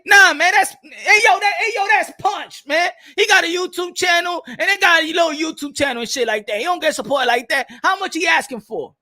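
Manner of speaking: barge in with mock indignation; worked-up intruder act. Acts intruder crashing the interview.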